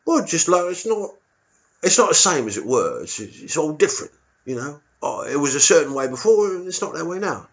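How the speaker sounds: a cagey East Londoner dodging the question; a touch of a cagey Londoner; vague local. Retold or imitated.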